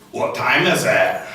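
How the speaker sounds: slow, put-on Scottish accent. Shown as a demonstration. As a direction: draw it out in a creepy Scottish accent. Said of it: "Scottish, I feel bad for those people".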